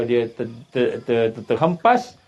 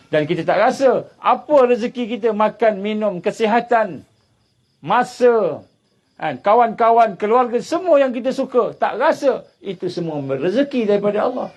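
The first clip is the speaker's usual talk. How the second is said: raised, driving sermon voice, building the drama; preaching at full force; 10 semitones higher, 4 dB louder than usual. Acted. Preacher to his congregation, telling of the tsunami, fired-up sermon delivery. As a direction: push the story like a fiery preacher. Preacher lists God's gifts.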